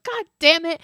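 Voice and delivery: a hint of an annoyed boss; upset curse. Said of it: "they're so upset about this".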